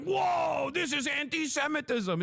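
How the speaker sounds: high, yelped mock outrage; mock offended shriek. A streamer mocking the guest crying anti-Semitism, high mock yelp. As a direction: squeal it like a man hamming up being offended. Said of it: "And he's like".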